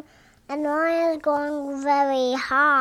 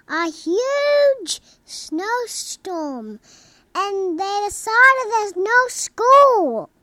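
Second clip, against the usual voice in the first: excited; 5 semitones higher, 8 dB louder than usual. Real, not acted. Excited snowstorm news, no school.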